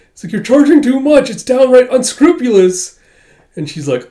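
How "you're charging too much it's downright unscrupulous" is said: high, loud whiny protest; squealing complaint. Acted. Client whines at Miss Tao over the fee, squealing. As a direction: whine shrilly about the price.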